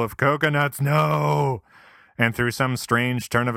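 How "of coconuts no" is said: mock horror. Sudden horror at coconuts.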